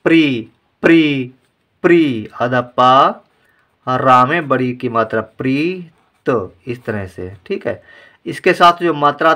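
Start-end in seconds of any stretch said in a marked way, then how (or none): 0.0-2.3 प्री stretched slowly, half प plus री; slow syllable drill. Shown as a demonstration. Teacher sounds out प्री slowly for children. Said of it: "तो क्या कहेंगे". 5.2-6.3 प्रीत drawn out very slowly and softly; very slow model word. Shown as a demonstration. Teacher blends the full word प्रीत slowly for children. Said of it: "तो क्या कहेंगे".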